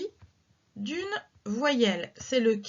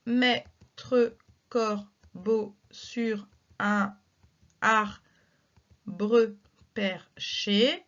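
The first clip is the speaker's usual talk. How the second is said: very slow, word by word scansion; slow verse reading; much slower than usual. Shown as a demonstration. Teacher reads La Fontaine slowly for syllable counting. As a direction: draw out each syllable so pupils can count. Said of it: "Pas forcément de difficulté ici".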